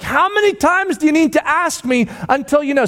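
high-pitched hurt protest; grieved, exasperated. Acted. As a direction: protest as if asked once too often. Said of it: "Peter was grieved".

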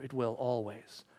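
usual speaking voice

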